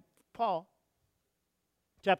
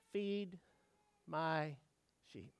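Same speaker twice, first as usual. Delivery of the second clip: soft, low, spaced-out words; tender charge; 5 semitones lower, 8 dB quieter, much slower than usual. Acted. Jesus entrusting his sheep to Peter, tender charge. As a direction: say it gently, pausing after each word.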